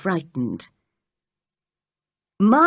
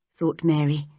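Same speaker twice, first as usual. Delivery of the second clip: ordinary delivery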